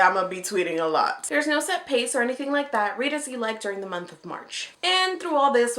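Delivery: usual speaking voice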